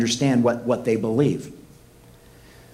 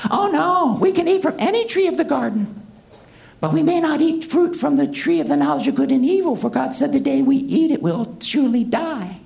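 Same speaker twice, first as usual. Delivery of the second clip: put-on much higher, naive girlish Eve; Eve's voice; 11 semitones higher than usual. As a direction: squeak it out like a naive young Eve. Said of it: "That's the way she talked".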